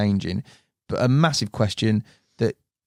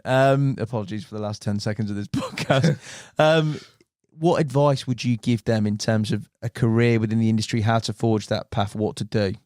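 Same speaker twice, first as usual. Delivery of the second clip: normal talking voice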